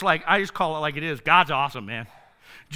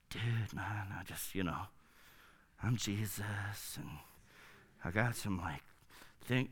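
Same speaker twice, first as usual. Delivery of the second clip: mock slacker dude; 12 semitones lower, 17 dB quieter than usual. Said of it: "I don't see Jesus being like".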